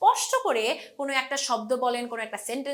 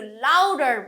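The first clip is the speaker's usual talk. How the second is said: Louder punched louder than its neighbours; stress on louder; 5 dB louder, slower than usual. Shown as a demonstration. Teacher showing what stress means, louder said louder. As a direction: hit louder hard. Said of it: "একটু loud করে বললাম".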